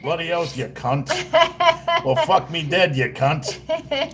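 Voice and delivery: comic Australian imitation; mock Australian. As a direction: play up a rough Aussie accent for laughs. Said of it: "Like in Australia".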